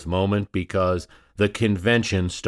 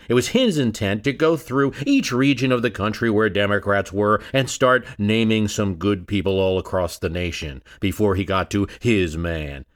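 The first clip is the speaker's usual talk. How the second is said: ordinary talk, nothing marked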